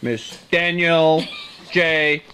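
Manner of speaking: slow, low show introduction; mock announcer. Announcer opens a show live from Carnegie Hall, mock-grand. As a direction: announce the star with spaced-out pauses.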